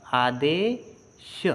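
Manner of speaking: drawn-out "Adesh", syllable by syllable; sounding out. Shown as a demonstration. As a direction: stretch "Adesh" slowly, splitting its sounds for learners. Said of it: "ध्यान से पढ़ो".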